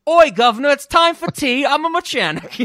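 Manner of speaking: loud, high, hammed-up Cockney; Jason Statham. Host mocking what he actually says, in shouted exaggerated Cockney. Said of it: "exactly what Jason Statham sounds like".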